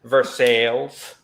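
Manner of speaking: slow "Versailles" as ver-SALES; local pronunciation. Shown as a demonstration. Missourian repeating it for puzzled friend, slow ver-SALES for "Versailles". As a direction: draw out "Versailles" to rhyme with sales. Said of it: "we also pronounce it Versailles".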